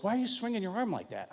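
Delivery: high, puzzled wife imitation; questioning wife. Wife asks about his swinging arm, mock puzzled.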